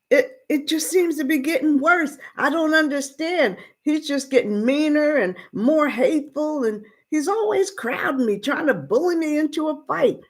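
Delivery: put-on teenage son pouring out his hurt to his father; upset boy. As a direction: plead with Pop like a troubled kid. Boy Mark pleads with his father.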